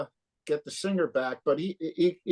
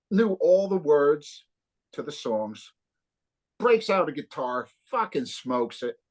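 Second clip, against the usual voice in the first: ordinary talk, nothing marked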